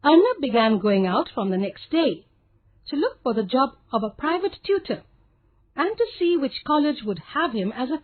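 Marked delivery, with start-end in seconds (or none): none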